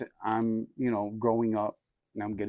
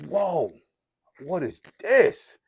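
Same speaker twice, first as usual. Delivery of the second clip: high, slow, wondering whoa as the first hit lands; amazed whoa; 6 semitones higher, 4 dB louder, much slower than usual. Retold or imitated. Teen trying crack for the first time, high and slow with amazement. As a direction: voice climbs up in awe.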